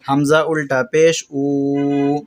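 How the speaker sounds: unhurried, slow spelling drill; calm slow spelling. Shown as a demonstration. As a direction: spell each syllable calmly, no rush. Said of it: "اس طرح اطمینان کے ساتھ". (اطمینان سے آہستہ ہجے)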